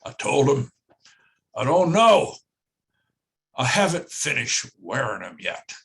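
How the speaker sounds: put-on a touch of a ninety-year-old teacher teasing the room; Doctor Deming's voice. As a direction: deliver the punchline as the old quality guru would. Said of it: "and I'll try to do his voice".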